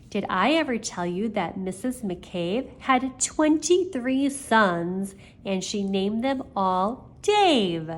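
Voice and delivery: usual speaking voice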